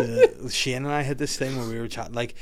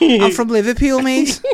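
high, loud hammed-up Scouse; Liverpool accent; 11 semitones higher, 8 dB louder, slower than usual. Shown as a demonstration. Mocking how accent-doers name their city, loud Liverpool accent. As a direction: squawk it in mock Scouse. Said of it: "anytime you do an accent".

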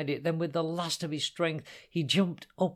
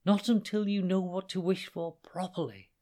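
scolding; 7 semitones higher than usual. Acted. Hannah scolds.

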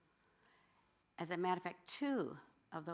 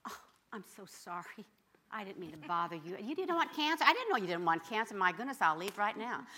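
mock polite devil; 3 semitones higher, 6 dB louder than usual. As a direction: play the devil as falsely sorry and innocent. Mocking the devil's fake apology.

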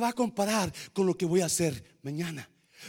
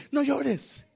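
normal talking voice

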